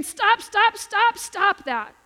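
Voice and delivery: put-on high loud cries of stop, in a child's panic; retold screaming. Child outside screaming at his friend, loud high cries. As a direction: shout it like a kid crying out from the yard. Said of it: "she hears screaming out there".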